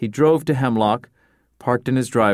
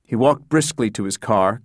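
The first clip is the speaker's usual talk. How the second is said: usual speaking voice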